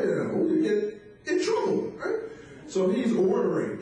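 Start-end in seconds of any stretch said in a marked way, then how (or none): none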